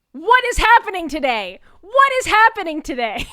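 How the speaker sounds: loud, high-pitched wail of disbelief; hammed-up exasperated shouting; exasperated outcry. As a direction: yell it high, utterly bewildered. Her retold exasperated outcry to fan.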